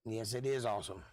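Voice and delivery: ordinary delivery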